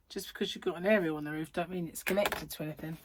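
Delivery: ordinary talk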